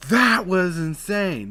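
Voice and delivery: loud and high, drawn out slowly; belly push. Shown as a demonstration. Vlogger demoing a voice tip, loud belly push. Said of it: "That's me pushing with my belly".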